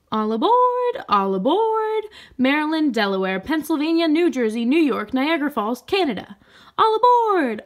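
high, loud call of a train conductor; loud, ringing all aboard call. A narrator's reading. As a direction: sing out the stops to the whole sky. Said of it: "announced the schedule".

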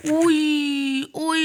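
high, loud wailing oy; anguished cry. Real, not acted. Gamer cries out as he gets hit. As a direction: cry out in pain at the screen.

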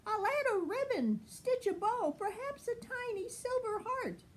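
high, fast, delighted tailor; tailor's voice. Acted. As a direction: chirp it out, thrilled with the undies. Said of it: "and then someone said".

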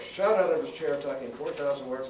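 usual speaking voice

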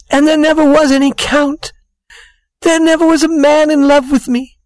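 high-pitched, weepy woman's confession; tearful. A narrator's reading. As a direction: confess through acted tears, voice pushed way up. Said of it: "began to cry".

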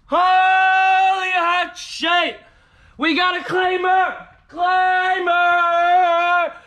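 high, loud victory chant; triumphant yelling. Real, not acted. Celebrating a lottery win with viewers, loud triumphant chant. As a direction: shout it out like a champion.

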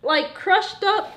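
can't believe they hate relish; excited disbelief. Real, not acted. Defending relish, disbelieving friend.

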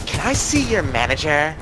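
lightly put-on complaining customer; a hint of an entitled customer; customer demanding the manager. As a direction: mimic a customer making a pointed demand. Retelling an irate customer's demand.

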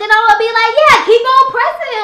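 loud, heated yelling back; angry shout. Acted. Sister yelling at Jimmy not to bring Cairo in, loud and angry. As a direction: shout him down in anger.

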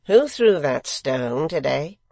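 loud, slow question; secretary, probing. A narrator's reading. Secretary suddenly questioning the two men, probing. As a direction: put the question slowly and pointedly.

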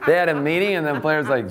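normal talking voice

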